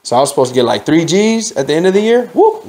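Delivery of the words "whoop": a high, sudden whoop for money vanishing. Real, not acted. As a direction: pop it up like it just vanished. Bonus suddenly lost.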